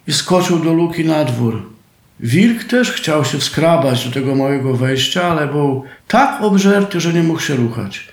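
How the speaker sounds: ordinary delivery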